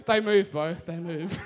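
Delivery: real giggle through the words; amused laughing. Real, not acted. Speaker joking about own paintings to audience, laughing. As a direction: crack up while saying it.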